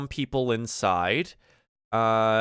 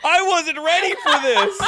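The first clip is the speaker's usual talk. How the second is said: real high, loud laughing cry; laughing outburst; 9 dB louder than usual. Real, not acted. Game master caught off guard by a player's antics, laughing loudly. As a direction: burst out laughing through the words.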